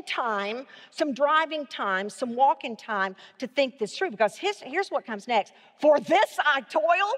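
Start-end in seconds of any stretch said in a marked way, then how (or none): 5.8-7.1 higher, louder, slow disbelieving rise; mock-incredulous, asking it back; sarcastic question. Shown as a demonstration. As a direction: scoff at it as a question. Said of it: "put some sarcasm in it, and we're gonna make it a question".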